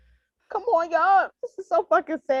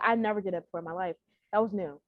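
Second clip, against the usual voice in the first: usual speaking voice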